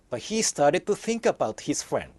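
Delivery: ordinary delivery, nothing marked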